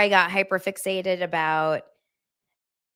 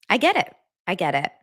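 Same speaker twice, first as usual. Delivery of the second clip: ordinary talk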